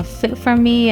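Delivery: ordinary delivery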